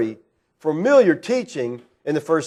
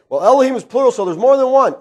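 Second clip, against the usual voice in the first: higher-pitched mock objection; lightly mocked know-it-all; naive objector; 6 semitones higher, 4 dB louder, faster than usual. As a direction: imitate people jumping to a conclusion. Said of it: "a lot of people say".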